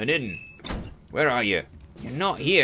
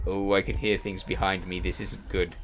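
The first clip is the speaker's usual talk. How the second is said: normal talking voice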